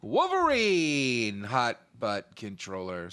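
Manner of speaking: usual speaking voice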